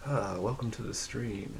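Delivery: soft, slow ASMR welcome. Shown as a demonstration. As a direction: ease into a gentle ASMR stream greeting. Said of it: "Do I have an A S M R voice?".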